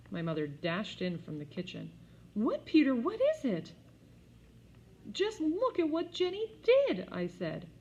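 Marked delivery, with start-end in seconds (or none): 2.3-3.7 high, loud worried question; alarmed mother. A narrator's reading. Mother dashing in to Peter, loud alarmed. 5.1-7.1 high, loud indignant cry; outraged tattle. A narrator's reading. Peter reporting Jenny to mother, high outraged.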